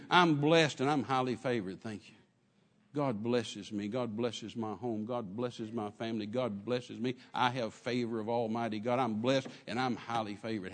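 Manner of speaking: mean every word, from the spirit; sincere from the heart. Shown as a demonstration. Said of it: "If it's coming from here".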